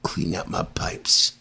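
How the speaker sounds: soft, slow, hushed come-on; breathy whisper. Pretend. Kimba whispering back to the maintenance man, sultry and slow. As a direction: whisper it like a seductive invitation. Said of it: "And Kimba's like".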